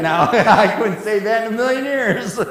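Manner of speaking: high laughing aside; real laughter while he admits defeat. Real, not acted. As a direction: laugh through the words. Preacher laughing at Spanish.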